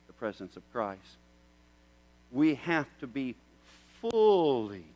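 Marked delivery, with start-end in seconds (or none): 2.3-4.8 fully drawn out, prompting; preacher prompting the word; fully stretched. Shown as a demonstration. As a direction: leave the word hanging for the crowd. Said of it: "what's the rest of that word?".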